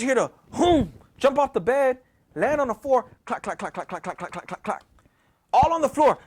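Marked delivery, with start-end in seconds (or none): 3.2-4.8 soft, lower clack-clack patter; rapid clacking noise. Retold or imitated. Something lands and runs across the floor, soft clacking. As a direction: imitate light, even running clatter.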